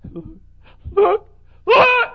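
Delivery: very high, loud shout, near crying; ecstatic cry. Retold or imitated. Preacher voicing the son crying out in joy.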